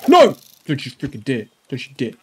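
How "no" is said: high, loud shout of dismay; alarmed no. Real, not acted. Gamer yelling at his wolf, loud dismay. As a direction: shout to stop it.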